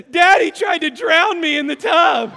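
loud, high, laughing mock wail of a child; crying child. Son accusing dad to mom, mock crying wail. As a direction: wail it like a tattling little boy. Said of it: "he says".

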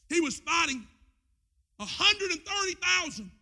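rising sermon cry; preaching build. Acted. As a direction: swell it up for the crowd. Preaching about Gideon's odds.

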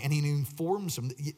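ordinary delivery

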